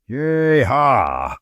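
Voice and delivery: a high, hammed-up cowboy holler; cowboy whoop. Host hyping listeners for the western, a high cowboy whoop. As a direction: whoop it up like a rodeo rider.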